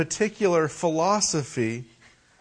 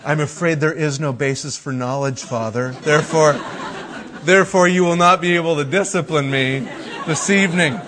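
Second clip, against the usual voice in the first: pompous little professor, with a laugh; mock philosopher child. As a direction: lecture dad like a smug scholar. Son voiced as mock philosopher.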